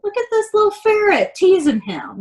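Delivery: high-pitched delighted look-at-this; excited pointing. Real, not acted. Showing picture to children.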